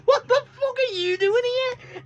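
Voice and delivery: high, slow, put-on voice of disbelief; mock startled yell. Wrestler spotting Lio Rush backstage, high mock shriek. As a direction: squeal it way up high like a shocked wrestler.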